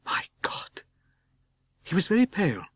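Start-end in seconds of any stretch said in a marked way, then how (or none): 0.0-0.8 breathless voiceless gasp; shocked whisper at the sight of the Count; under his breath. Acted. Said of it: "He said under his breath".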